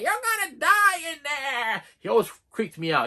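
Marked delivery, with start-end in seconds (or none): 0.0-1.8 high, slow, drawn-out warning of doom; slow eerie old man wail; creepy old man. Retold or imitated. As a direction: croon it like a ghostly prophet. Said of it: "He's like".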